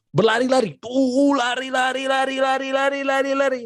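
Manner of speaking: high, playful chant of lari; hammed-up kid excitement; excited kids running. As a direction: mimic children running about gleefully. Kids running around, acted excitement.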